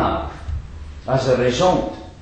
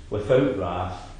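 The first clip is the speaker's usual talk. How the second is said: "without wrath" said lower and softer, just summing up; quiet recap; 7 semitones lower, 6 dB quieter than usual. Shown as a demonstration. Preacher quietly summing up the three conditions, lower and softer. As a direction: drop "without wrath" low and quiet as a closing list item. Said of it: "Holy hands".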